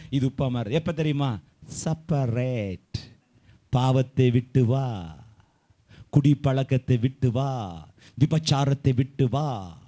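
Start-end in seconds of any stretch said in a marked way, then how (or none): 1.8-9.8 slow, low, drawn-out call; solemn summons. Real, not acted. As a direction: stretch each command out solemnly. Slowly calls people out of sin. (நிதானமாக இழுத்து அழைக்கும் கட்டளை)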